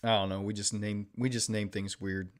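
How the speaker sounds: ordinary talk, nothing marked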